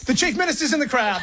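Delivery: high-pitched, laughing discovery; amused disbelief. Real, not acted. Host spots the Chief Minister in the fake crowd, amused disbelief. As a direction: blurt it out high, through a laugh.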